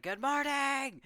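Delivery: high, loud mock announcer yell; wake-up shout. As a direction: holler it into the mic to rouse a sleeping camp. Retold wake-up call over microphone.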